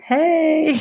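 high, drawn-out come-on; playful hammed-up hello; mock flirty greeting. Voicing flirty couple.

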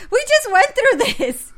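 high, loud exasperated protest; retold exasperation. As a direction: cry it out in disbelief. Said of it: "And I'm like".

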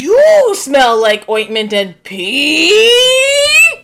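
high, loud, extremely stretched wail; mock howl. Co-host outdoing the cartoon insult, hammed-up long howl. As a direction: hold the last word long and high. Hosts trade louder takes.

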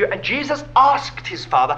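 normal talking voice

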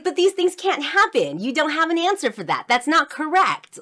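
loud, high-pitched retold tirade; going off. Child disputes the teacher's miracle explanation, going off. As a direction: argue back at full indignant pitch. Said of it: "and I just went off".

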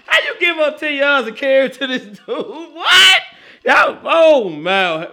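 loud and high, disbelieving; incredulous outburst. Real, not acted. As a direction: shout at the defense in disbelief. Mocking Ohio State's defense.

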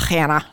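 Hannah with a raspy, throaty H; heavy, breath-scraped H on Hannah; exaggerated H sound. Shown as a demonstration. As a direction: hack out the H at the start of Hannah. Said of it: "talk about that H sound".

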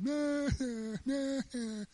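high-pitched, drawn-out fake wailing; hammed-up wordless boo-hooing; mock crying. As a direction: imitate a crowd bawling in their tents. Imitates a whole camp crying in tents.

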